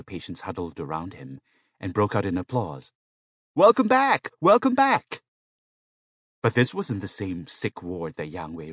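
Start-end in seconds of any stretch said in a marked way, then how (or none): 3.5-5.2 very high, loud cheer; cheering welcome. A narrator's reading. Patients huddle round the returning man, high cheering welcome. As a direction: call out like an applauding crowd.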